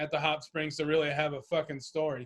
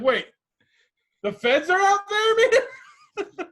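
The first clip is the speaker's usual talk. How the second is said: much higher, louder paranoid stoner squeal breaking into a laugh; 13 semitones higher, 8 dB louder, much slower than usual. Retold or imitated. Mocking his stoned friend's paranoia, high laughing squeal. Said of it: "Got you too stoned and like".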